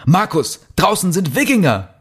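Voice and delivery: high, alarmed exclamation; horrified cry. Acted. Peter warns Markus of the men outside, horrified. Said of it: "schrie Peter entsetzt".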